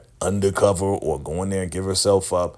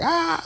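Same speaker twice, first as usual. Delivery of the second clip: short high scream. Retold or imitated. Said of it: "she screamed for a second".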